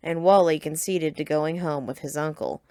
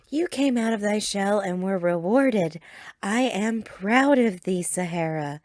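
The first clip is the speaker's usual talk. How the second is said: proud and tender; 2 semitones higher than usual. A narrator's reading. Praising her evolved Pokemon.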